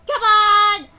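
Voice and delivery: loud teasing yell building to a shout; playful yell. Acted. Playful game taunt to character.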